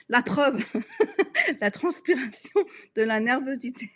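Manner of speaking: high, giggly embarrassed laugh through the words; words bubbling up through giggles. Real, not acted. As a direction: laugh while owning up to nerves. Laughing at her own nervous sweating.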